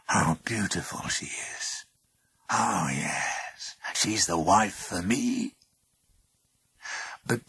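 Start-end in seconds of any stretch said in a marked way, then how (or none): 0.1-5.5 slow, hushed, half-whispered admiration; murmuring. A narrator's reading. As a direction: murmur it slowly as if savouring the sight. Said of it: "murmured Pluto".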